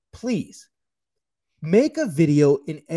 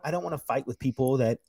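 ordinary talk

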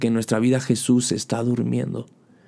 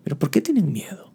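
hushed whisper, softly asking; whispered question; 4 semitones higher, 3 dB quieter, faster than usual. Acted. Jesus whispering to his frightened disciples, hushed. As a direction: whisper it like a gentle rebuke. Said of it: "Pero Jesús les dice".

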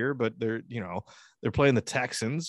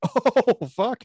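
mock shock; 13 semitones higher, 8 dB louder than usual. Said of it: "I'm like".

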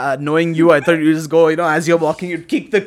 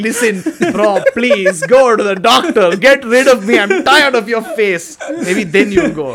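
loud, higher, fed-up begging; mock exasperated plea; 5 semitones higher, 6 dB louder than usual. Sleep paralysis demon to the dreamer, exasperated pleading. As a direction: plead at top volume, hammed-up exasperation. Demon begs him, see doctor.

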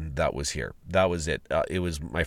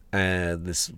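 normal talking voice

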